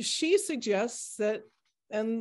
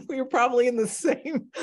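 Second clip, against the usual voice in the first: laughing; 5 semitones higher, 5 dB louder than usual. Real, not acted. Laughing over a shared college.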